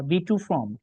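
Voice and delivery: ordinary talk, nothing marked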